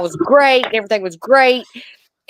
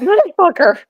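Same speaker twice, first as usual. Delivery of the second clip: higher exasperated swear; mock exasperated curse; 8 semitones higher than usual. Wife retells cursing at injured husband, mock exasperation. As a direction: spit it out in disbelief. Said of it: "I'm like".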